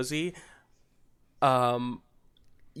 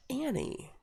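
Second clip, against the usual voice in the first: normal talking voice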